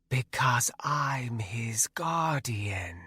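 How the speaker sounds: slow, eerie breathy wraith whisper. Acted. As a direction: hush it out like a shadow spirit. Wraith Nanaya speaks.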